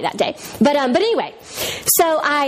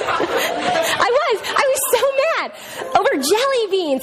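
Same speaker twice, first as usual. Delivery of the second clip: laughing; 10 semitones higher, slower than usual. Real, not acted. Laughing at own anger.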